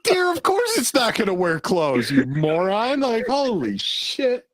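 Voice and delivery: exasperated rant. Real, not acted. Rant at a silly quote.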